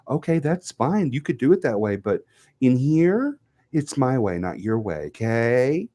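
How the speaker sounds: imitating her teacher. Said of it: "I had a teacher used to always tell me that".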